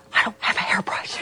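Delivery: unvoiced whisper with a laugh; whispered thought. Telling God she has no hairbrush, whispering. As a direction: whisper it to yourself. Thinking it to herself.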